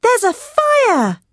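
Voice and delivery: slow, high-pitched fire alarm cry; alarmed shout. A narrator's reading. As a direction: call out a drawn-out warning to mother. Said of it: "I shouted to my mother".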